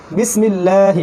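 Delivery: বিসমিল্লাহি with a light, thin ল. Shown as a demonstration. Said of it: "পাতলা পড়লাম".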